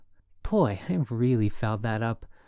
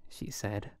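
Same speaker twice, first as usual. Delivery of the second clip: usual speaking voice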